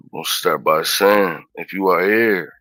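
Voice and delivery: mock preaching. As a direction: preach it to the congregation.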